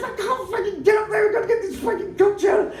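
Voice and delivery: very high, squealed mock protest; frantic refusal. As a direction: refuse in a hammed-up panic. Acted frantic refusal to the player.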